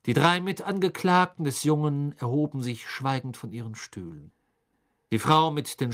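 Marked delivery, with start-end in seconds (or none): none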